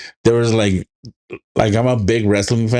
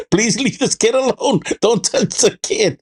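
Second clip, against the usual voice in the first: mock pleading; 11 semitones higher than usual. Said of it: "I'm like".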